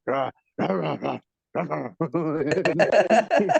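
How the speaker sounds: rising, drawn-out "rah" grunts; imitated Marine grunting. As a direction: grunt wordlessly like a mock Marine. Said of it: "like, the Marines, he's always like".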